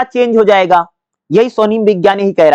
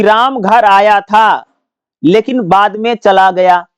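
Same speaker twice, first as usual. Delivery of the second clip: slow example; slower than usual. Shown as a demonstration. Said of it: "जैसे मैंने बोला ना".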